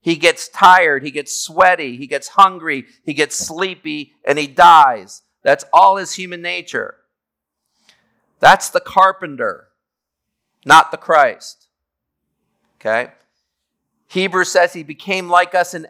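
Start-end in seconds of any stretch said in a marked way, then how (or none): 4.2-5.2 slow and louder on "and he dies"; emphatic. Acted. Climax of human weaknesses list. 8.4-11.7 slow, louder "not the Christ"; emphatic contrast. Acted. Contrasting Jesus' two natures.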